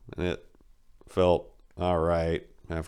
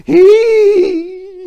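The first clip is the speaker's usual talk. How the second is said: put-on shrill old-man squeal, very high and loud; high squealing hee; 16 dB louder than usual. The old man squealing with glee on the bus. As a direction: let out a gleeful squeak. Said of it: "He trailed off".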